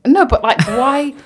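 loud, high why, half laughing; excited protest. Real, not acted. Friend protesting loudly about thirties, excited.

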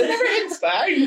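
very high, squeaky fake cheer through laughter; mock reassurance. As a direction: pretend all is well in a far too high voice. Mock reassurance emerging from hiding.

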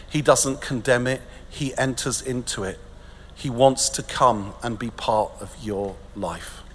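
hushed solemn preaching. Real, not acted. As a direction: preach gently to the hurting.

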